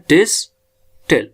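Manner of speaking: Distil in two separated beats; split into syllables. Shown as a demonstration. Teacher counts two syllables for learners, distil said in two parts. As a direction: break distil after डिस, then say टिल. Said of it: "दो syllable का word है".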